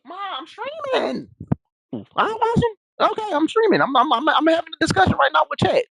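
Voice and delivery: real high-pitched protest to mom; high, insistent interrupted-streamer whine; calling to mom. Real, not acted. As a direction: call out high, pleading to be left alone.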